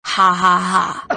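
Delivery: slow, spelled-out "ha ha ha"; deliberate, evenly spaced fake laugh; mock villain laugh. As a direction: stiff gloating laugh. Impostor gloats before revealing himself.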